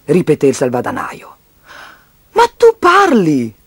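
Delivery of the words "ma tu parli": high, drawn-out astonishment; amazed. Acted. Child finds the piggy bank can talk, amazed. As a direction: stretch it out in amazed disbelief.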